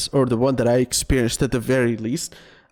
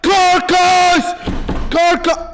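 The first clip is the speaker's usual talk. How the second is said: much higher, louder frustrated yell of the name; exasperated shout; 7 dB louder, much slower than usual. Real, not acted. Streamer shouting the subclass name at the game, exasperated.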